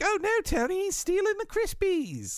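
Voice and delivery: high mock British squeak; a squeaky put-on British sidekick; high, far-off cartoon British voice; British chum voice. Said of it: "he was like".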